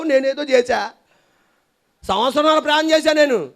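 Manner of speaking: heated complaint to God; aggrieved complaint. Retold or imitated. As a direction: protest to God with hurt pride.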